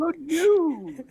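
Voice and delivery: put-on high, cracking old professor voice; Futurama professor impression. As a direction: squeak it like a senile scientist. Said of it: "trying to do the Futurama".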